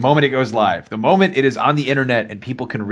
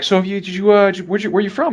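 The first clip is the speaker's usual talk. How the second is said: mock stammering rep; 9 semitones higher, faster than usual. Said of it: "they were like".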